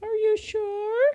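put-on high, slow, small girl's voice; little girl voice. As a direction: ask it like a timid little girl. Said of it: "this little girl reached out from the crowd and was like".

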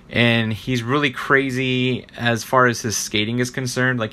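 ordinary talk, nothing marked

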